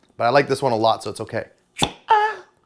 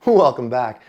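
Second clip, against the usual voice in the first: ordinary talk, nothing marked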